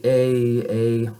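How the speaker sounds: "a" drawn as a diphthong, sliding toward ee; English-style gliding vowel. Shown as a demonstration. Teacher mimicking beginners' English habit, gliding "a". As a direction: let "a" glide as English speakers do. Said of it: "we might say ... that's often, uh, if an English-speaking person first learned French".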